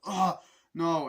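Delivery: high, loud cry of defeat; hammed-up "no" of dismay; mock anguished cry. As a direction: play a movie villain getting beaten. Acting the beaten villain.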